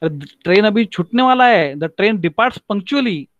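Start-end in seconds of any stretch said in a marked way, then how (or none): none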